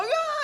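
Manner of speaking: very high mimicked cheer; quick high-pitched hooray; crowd cheer. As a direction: squeal like delighted kids. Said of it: "they all go".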